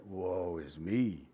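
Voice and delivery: humbled lament. Retold or imitated. Isaiah seeing the Lord on his throne, soft slow lament. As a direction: let it fall out of him, undone. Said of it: "And he's like".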